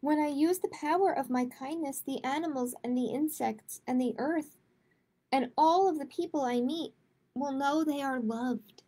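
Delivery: gentle, measured picture-book read-aloud; reading to children. A narrator's reading. Librarian reading a kindness book to kids, gentle read-aloud.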